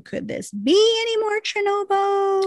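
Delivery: sitcom-quote tune, leaping up on "be"; Friends catchphrase intonation. Retold or imitated. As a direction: push "be" way up in pitch and stay high to the end. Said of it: "makes an obscure Friends reference saying".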